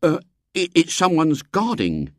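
put-on high, hesitant boy's protest; aghast. A narrator's reading. William objecting at the gates, aghast. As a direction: stammer a worried objection. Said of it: "Even William was aghast".

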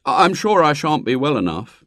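mild whining plea; a boy wheedling his mother; pleading. A narrator's reading. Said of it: "he pleaded to his mother".

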